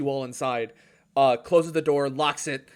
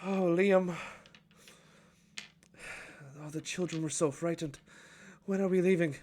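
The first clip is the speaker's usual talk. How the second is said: higher, fretful elf voice; worried mother asking; elven woman; 6 semitones higher than usual. Acted. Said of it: "she comes down and she says".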